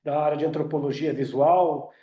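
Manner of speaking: ordinary talk, nothing marked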